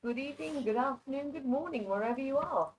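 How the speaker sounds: usual speaking voice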